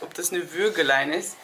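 ordinary talk, nothing marked

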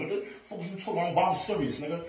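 ordinary talk

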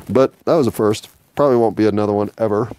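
normal talking voice

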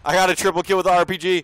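loud, fast gamer boast; excited brag. Real, not acted.

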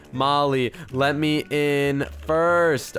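word by word as if typing it; dictating while typing. Real, not acted. Types the message, then repeats it.

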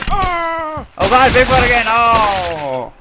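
high, loud shout of alarm; startled scream. Real, not acted. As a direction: scream as the monster reappears. Monster appears again in game.

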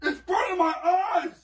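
high, slow cry of pain; pained wail. Real, not acted. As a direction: wail that it hurts. Grandson's eyes burn after prank.

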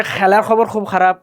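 Sylheti fricative for each kh; Sylheti accent. Retold or imitated. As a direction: scrape the kh like a Sylheti speaker. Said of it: "সিলেটিদেরকে বলবেন". (সিলেটি টানে ঘষা খ)

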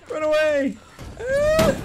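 high, shouted panicked run away; panicked cry. Real, not acted. Gamer fleeing an attacker in the game, panicked shout. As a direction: yell it in a panic as you flee.

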